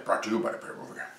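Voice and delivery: fast, soft rushed sponsor tag; quick disclaimer. Acted. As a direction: rattle it off like small print.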